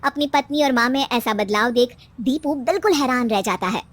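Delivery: ordinary delivery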